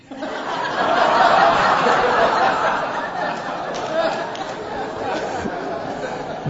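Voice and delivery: high silent-shaking laugh; long wheezing laugh. Real, not acted. Preacher laughing at his own cop joke, wheezing. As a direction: laugh breathlessly at the punchline.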